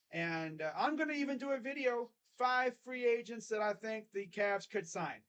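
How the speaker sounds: ordinary delivery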